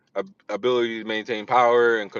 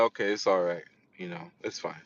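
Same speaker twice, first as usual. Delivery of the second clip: low, soft retold reassurance; gently calm someone who is crying; soothing; 6 semitones lower, 9 dB quieter than usual. Comforting her as she cries.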